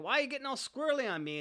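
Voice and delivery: quick, high-pitched accusation, acted out; scorned complaint. Girlfriend feels scorned, starts a fight.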